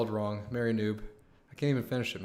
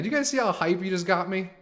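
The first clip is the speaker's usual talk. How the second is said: ordinary talk, nothing marked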